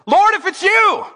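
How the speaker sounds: high, loud call to the Lord; Peter calling out. Acted. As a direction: call out boldly across the water. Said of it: "Peter replied".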